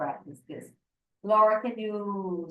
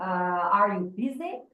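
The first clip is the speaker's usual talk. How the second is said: slow "Are you busy?" with the pitch rising up on "busy?"; rising intonation demo; 3 dB louder, slower than usual. Shown as a demonstration. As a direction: say "Are you busy?" slowly, lifting the end up on "busy?". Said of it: "every yes or no question, we do the rising intonation".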